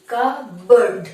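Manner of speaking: কাপবোর্ড as kuh-burd, p silent; correct pronunciation. Shown as a demonstration. Teacher modelling the word for students, correct pronunciation. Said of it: "আমরা উচ্চারণ করব বার্ড".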